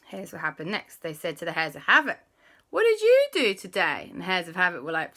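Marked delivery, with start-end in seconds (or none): 2.7-4.1 put-on high, eager kid voice; boys' voice. Alexander and Christopher offering to call Andy, high. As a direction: play the boys, pitched up. Asking the Hares.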